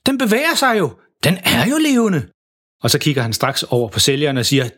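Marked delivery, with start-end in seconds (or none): 0.0-2.2 high-pitched astonishment; very surprised. A narrator's reading. Dad discovers the creature is alive, very surprised. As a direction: exclaim it in rising amazement. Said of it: "siger far meget overrasket".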